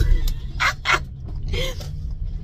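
breathy laugh. Real, not acted. Laughs after the sing-song.

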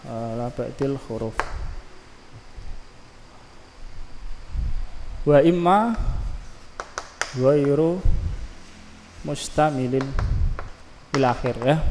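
measured recitation of an Arabic text; slow reading of the text. Acted. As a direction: read the Arabic phrase slowly, word by word.

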